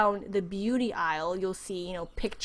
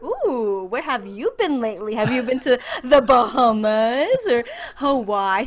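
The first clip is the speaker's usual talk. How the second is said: rising, louder, laughing through the question; excited mock praise; 3 semitones higher, 8 dB louder than usual. Imitating Americans admiring a tan, loud laughing excitement. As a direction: gush over the tan, half laughing.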